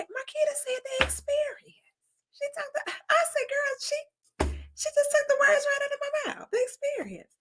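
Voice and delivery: high, breathless retold squeal; squealing excitement. Telling Gracia Marquita stole her word, squealing. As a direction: gush it up high.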